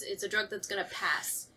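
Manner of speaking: usual speaking voice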